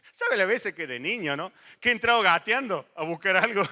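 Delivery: ordinary talk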